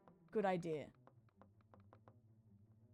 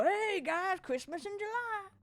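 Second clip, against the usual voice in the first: higher, hammed-up mock Colonel; a playful spooky Colonel greeting; lightly voiced Colonel, higher; 10 semitones higher, slower than usual. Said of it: "hearing me go".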